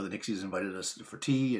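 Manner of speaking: ordinary talk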